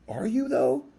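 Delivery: high-pitched, doubting question; sceptical challenge. Retold or imitated. Retold challenge to doubting friends.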